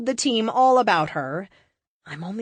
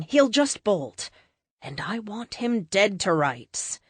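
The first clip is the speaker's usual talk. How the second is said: usual speaking voice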